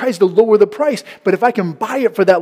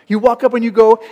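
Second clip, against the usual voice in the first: ordinary delivery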